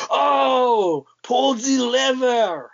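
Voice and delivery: put-on high, drawn-out Russian-accented cry; Russian movie character. Quoting the Russian in Armageddon to a friend, high mock Russian cry. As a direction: stretch out a mock Russian shout. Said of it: "He was the Russian in Armageddon".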